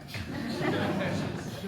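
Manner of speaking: soft unvoiced chuckle; a hushed laugh at the grim joke; airy breathy laugh. Real, not acted. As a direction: let out a quiet breathy laugh. Laughing at own punchline.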